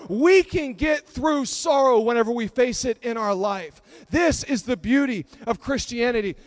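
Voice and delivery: loud, emphatic sermon build; impassioned preaching. Real, not acted. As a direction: drive each line home to the congregation. Preaches at full force.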